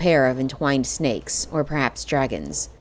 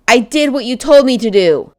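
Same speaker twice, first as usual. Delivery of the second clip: loud, high desperate cry; desperate shout; 9 semitones higher, 9 dB louder than usual. A narrator's reading. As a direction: yell it, close to breaking. Pleading shout at the witch.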